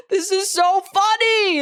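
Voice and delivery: high, loud, drawn-out glee; hammed-up fan delight; delighted outcry. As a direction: squeal it slowly, thrilled and amused. Acting out own delighted reaction.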